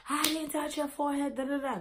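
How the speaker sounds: high-pitched mimicry of a friend; mocking denial. Friend denies touching her forehead, high mocking imitation. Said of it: "talking like this".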